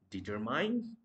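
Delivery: determine ending like "mine", as a question; mispronounced determine. Shown as a demonstration. Teacher quizzing learners on the wrong form, mispronounced determine. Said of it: "No, that's not going to be determine".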